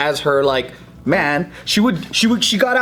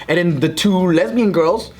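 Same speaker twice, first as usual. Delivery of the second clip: ordinary talk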